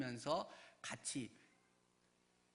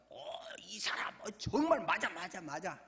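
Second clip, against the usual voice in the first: confident insistence; 3 semitones higher, 5 dB louder than usual. Retold or imitated. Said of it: "장담하면서 막 확신 있게 이야기를 합니다". (장담하듯 확신 있게 흉내)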